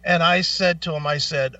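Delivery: ordinary talk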